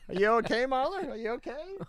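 high-pitched, softening fussing over Mahler; concerned cooing. Real, not acted. Host to Mahler who just tripped, concerned cooing. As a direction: check tenderly on the one who tripped. Checking on Mahler after a trip.

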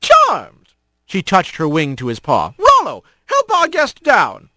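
a fond mom scolding lightly; cheerful mother hawk. Acted. Mother greeting her son at the door, high and bright.

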